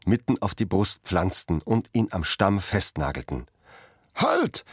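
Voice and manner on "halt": sudden, very high shout; shouted halt. A narrator's reading. Tenant stopping the peasants with a sudden high shout. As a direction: stop them all with one cry. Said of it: "schrie da eine Stimme".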